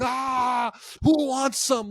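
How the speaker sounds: high-pitched mock battle cry; mock scream. A fan reenacting his table-top rage at a party, high mock yell.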